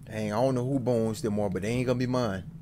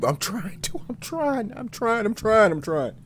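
building plea; 9 semitones higher, 4 dB louder, slower than usual. Real, not acted. Pleading with chat while scared.

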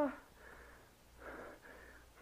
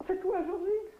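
higher, with a sob in the voice; tearful call; 6 semitones higher, 3 dB louder than usual. Acted. Dying wife tearfully calls to the servant Georgine. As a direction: call out through tears.

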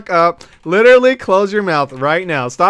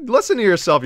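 usual speaking voice

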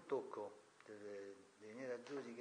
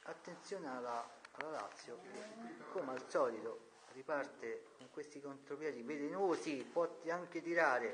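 building football commentator call; sports commentary; 3 semitones higher, 5 dB louder than usual. Pretend. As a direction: rise into the shot like a commentator. Mock match commentary.

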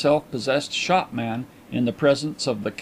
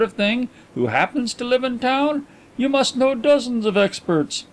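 a lightly raised, eager detective voice; the inquiry agent reintroducing himself, keen and higher; Mister Carlyle voiced; 8 semitones higher than usual. A narrator's reading. Detective greeting the coin dealer, lightly voiced higher.

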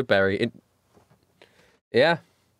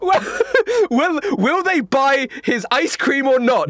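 slow, dramatic to be continued; mock trailer voice; cliffhanger teaser; 7 semitones higher than usual. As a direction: tease the next episode like an announcer.

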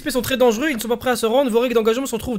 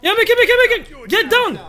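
high urgent shout to the team; warning shout; 9 semitones higher than usual. Real, not acted. Spotting enemy, warning team.

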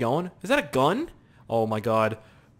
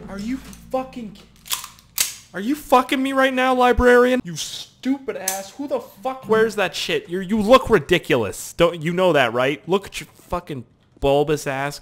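mock rant; 7 semitones higher, 5 dB louder than usual. Real, not acted. Rants at the librarian.

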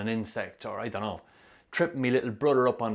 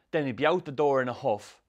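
ordinary delivery

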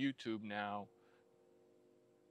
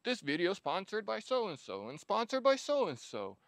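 high, mocking sponsor read; 9 semitones higher, 4 dB louder than usual. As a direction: parody an ad read. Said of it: "a hundred videos".

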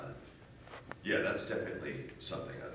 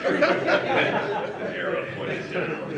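laughing; 13 dB louder, much slower than usual. Real, not acted. Laughing at own gravestone joke.

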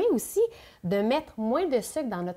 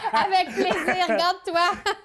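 high, laughing reply; 9 semitones higher, 4 dB louder, slower than usual. Real, not acted. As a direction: laugh through the words. Laughing while offering tasting.

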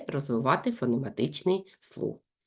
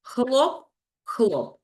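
clap sounds; 5 semitones higher than usual. Shown as a demonstration. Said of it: "Плескаємо два рази".

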